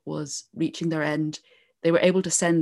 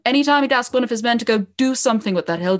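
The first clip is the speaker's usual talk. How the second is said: epic fantasy narrator; 2 semitones higher than usual. Acted. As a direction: build the legend like a fireside tale. Starts telling the O'Neill legend.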